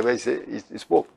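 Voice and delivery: ordinary talk, nothing marked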